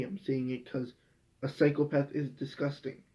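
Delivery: ordinary talk, nothing marked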